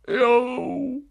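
plain reading voice

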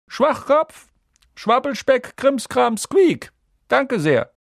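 slow, high, loud eccentric address; headmaster's speech. A narrator's reading. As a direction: deliver nonsense words grandly. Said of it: "Dumbledore's speech". (exzentrische, laute Festrede)

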